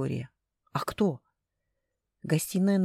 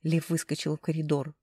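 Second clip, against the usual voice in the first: usual speaking voice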